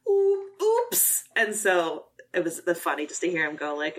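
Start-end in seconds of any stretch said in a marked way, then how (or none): 0.1-1.2 squeaky high sheepish oops; mock director oops. Quoting the director's commentary in a high sheepish oops. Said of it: "he was like".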